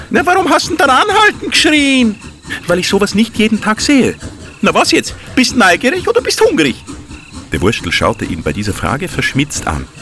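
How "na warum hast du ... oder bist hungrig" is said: cheeky high little fellow. Acted. Wurstel character voice.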